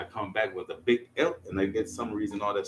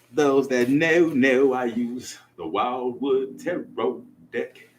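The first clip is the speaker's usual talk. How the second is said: loud slow announcement; 5 semitones higher, 9 dB louder, much slower than usual. Real, not acted. Loud slow intro of deck.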